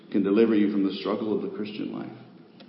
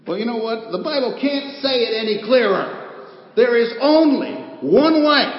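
much higher and louder, slow on "only one way"; full-force preaching; 9 dB louder than usual. Acted. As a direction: proclaim it like the final word. Climax of sermon point.

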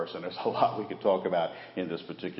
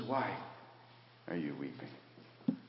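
gentle Jesus; 11 dB quieter, much slower than usual. Retold or imitated. Jesus to weeping Mary, slow gentle question. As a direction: ask it tenderly, slowly.

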